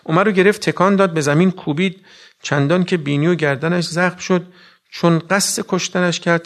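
a preacher thundering the fight story to the mourners; preaching at full force. Acted. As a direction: build the fight scene with fire.

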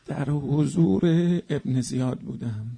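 ordinary delivery

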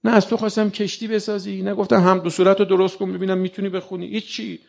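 ordinary delivery

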